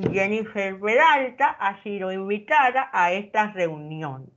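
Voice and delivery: lightly muffled words around a pencil; reading with a pencil in the teeth. Shown as a demonstration. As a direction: read it aloud with a pencil between your molars. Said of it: "Y leo en voz alta cualquier cosa". (leyendo con un lápiz entre los dientes)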